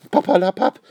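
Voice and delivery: high, loud cross outburst; scolding. A narrator's reading. Lady brushes off Papa Wappa's excuse, scolding. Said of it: "schimpft die Dame".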